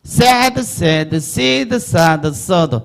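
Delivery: teacher's rhythmic run of sad, sad, sid, sad, sod; vowel reading drill. Shown as a demonstration. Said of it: "모음 읽어보는 연습하는 거예요".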